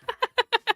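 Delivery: short high giggle at the compliment; bashful laugh. Real, not acted. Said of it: "Your voice is beautiful. It's very sultry".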